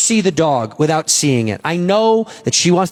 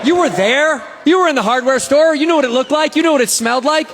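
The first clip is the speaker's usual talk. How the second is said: gleeful taunting; 6 semitones higher than usual. Real, not acted. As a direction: rub it in, grinning. Comedian taunting audience after reveal.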